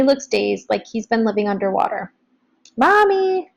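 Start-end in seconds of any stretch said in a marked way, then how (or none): 2.8-3.5 high, raised call to her mother; bright child's call. A narrator's reading. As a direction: call out across the house. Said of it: "she calls to Missus Madaman".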